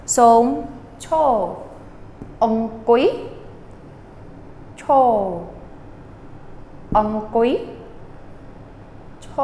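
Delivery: cho and ong kui alternated slowly and clearly; som cho drill. Shown as a demonstration. Teacher drills the phrase for students, slow separate pieces. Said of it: "Very simple".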